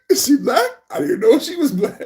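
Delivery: high-pitched amused mimicry; Trump. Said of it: "Trump added this inflection".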